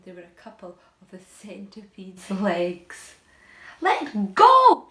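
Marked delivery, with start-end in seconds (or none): 3.8-4.9 high, loud yell; furious shout. A narrator's reading. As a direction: bellow at the boy grabbing his legs. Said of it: "shouted the centipede".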